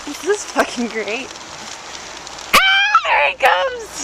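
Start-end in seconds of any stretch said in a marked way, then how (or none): 2.5-4.0 high excited call; excited shout. Real, not acted. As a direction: shout out as he arrives. Calling out at friend arriving.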